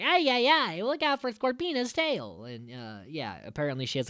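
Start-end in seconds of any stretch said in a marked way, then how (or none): 0.0-2.4 higher, fretting mock Italian; Italian accent. Alpha warning the Rangers again, hint of Italian accent. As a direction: cry out in a light Italian accent. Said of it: "he's like".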